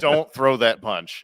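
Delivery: drawn-out imitated caution; slow mock warning. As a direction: stretch it out, word by word. Same warning repeated emphatically.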